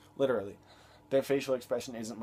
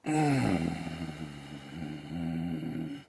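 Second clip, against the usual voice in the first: long exasperated sigh; heavy mock sigh; 5 semitones lower, 4 dB louder than usual. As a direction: breathe out loud like a weary reaction shot. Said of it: "reaction shots".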